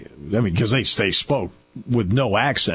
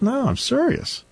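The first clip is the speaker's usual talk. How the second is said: much higher, slow insisting; mock earnest insistence. Speaker retelling how he insisted to his colleague. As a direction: plead to be believed in a high voice.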